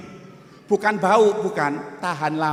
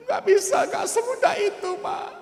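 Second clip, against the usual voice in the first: much higher, tearful refusal; tearful protest. Retold or imitated. Young man refuses the pastor's comfort, tearful and high. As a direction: cry out that it isn't that easy. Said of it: "Dia nangis".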